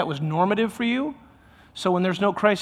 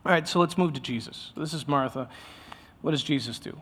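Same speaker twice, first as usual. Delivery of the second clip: usual speaking voice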